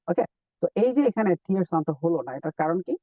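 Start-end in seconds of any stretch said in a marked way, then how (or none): none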